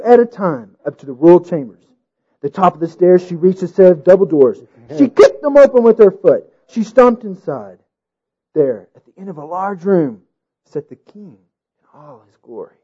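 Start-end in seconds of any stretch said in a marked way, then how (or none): none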